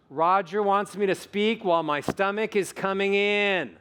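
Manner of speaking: sustained, fully projected demonstration line; supported projection. Shown as a demonstration. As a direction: push each word out on the breath. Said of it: "I'm gonna do it first".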